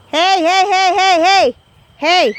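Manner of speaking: loud repeated calls of hey; shouting. Real, not acted. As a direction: shout hey to stop them. Shouting at child to stop.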